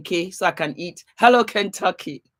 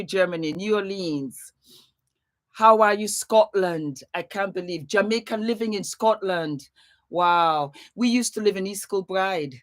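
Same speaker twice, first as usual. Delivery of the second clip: ordinary talk, nothing marked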